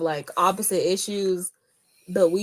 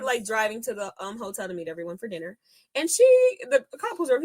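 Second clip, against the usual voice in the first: usual speaking voice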